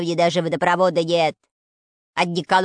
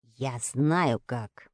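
hushed, slow secret; quiet; 8 semitones lower, 6 dB quieter, much slower than usual. A narrator's reading. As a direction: say it low and slow, conspiring. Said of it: "тихо сказал".

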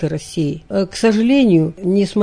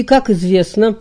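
normal talking voice

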